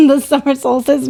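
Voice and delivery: words through a laugh. Real, not acted. Laughing about wedding date.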